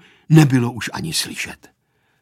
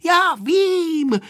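put-on high girlish cry back; high, slightly raised exclamation; 4 dB louder than usual. A narrator's reading. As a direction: shout back in a high child's voice. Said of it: "vykřikla Aneška".

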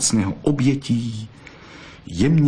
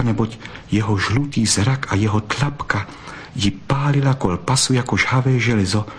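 plain reading voice

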